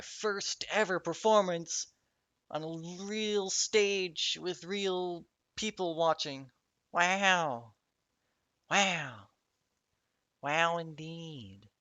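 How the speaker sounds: slow, high, self-mocking wow; mock awe. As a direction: gush over it sarcastically. Mock-awed at own milestone.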